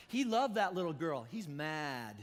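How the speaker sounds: normal talking voice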